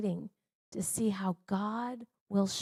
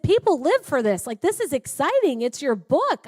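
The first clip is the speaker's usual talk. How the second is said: loud and higher, pushing enthusiasm; retold excited urging; 6 semitones higher, 10 dB louder than usual. Husband urges his wife to celebrate her book, loud and excited. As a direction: insist this is thrilling news.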